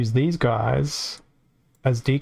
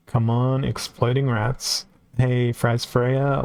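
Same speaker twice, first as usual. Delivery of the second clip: usual speaking voice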